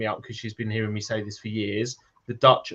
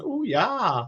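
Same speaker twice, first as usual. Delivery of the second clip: high-pitched mock Swedish lady; Swedish blonde fantasy; 11 semitones higher than usual. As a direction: coo it like a dopey Swedish blonde. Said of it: "a blonde lady just going".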